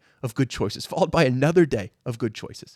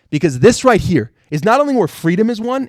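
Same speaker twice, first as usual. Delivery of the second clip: ordinary talk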